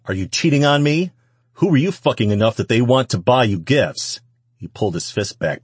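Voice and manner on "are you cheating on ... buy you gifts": loud, raised-pitch accusation; jealous fury. A narrator's reading. Man accusing the woman of cheating, jealous fury. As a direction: interrogate her angrily.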